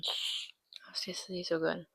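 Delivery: unvoiced whispered aside. Real, not acted. Savoring her drink, hushed whisper. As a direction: breathe it out as a secret.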